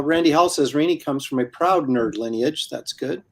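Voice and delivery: usual speaking voice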